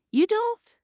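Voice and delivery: high, loud, shocked question; can't believe what she hears; stunned disbelief. A narrator's reading. Daughter who sends money every month, stunned.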